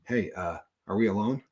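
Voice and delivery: low, hushed confidential aside; mock-secretive. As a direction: drop your voice as if sharing a secret. Mock secrecy before showing watch.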